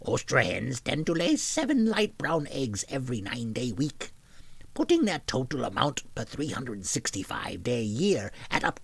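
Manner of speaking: a loud goblin, mostly held with a strange throat. Acted. Reading the chicken article to chat in a loud goblin voice. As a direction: keep the goblin going, loud and gnarly. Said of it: "I will try to do something that's goblin-esque".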